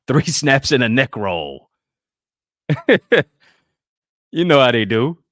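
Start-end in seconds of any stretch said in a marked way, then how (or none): 2.7-3.2 quick loud chuckle; brief high, punchy laugh. Real, not acted. Speaker amused by three snaps joke, loud chuckle.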